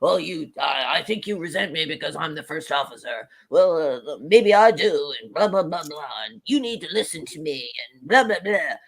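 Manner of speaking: mocking low-voiced argument trailing into blather; mock bickering officers. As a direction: parody two men pulling rank. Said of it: "the entire Chakotay and Tuvok".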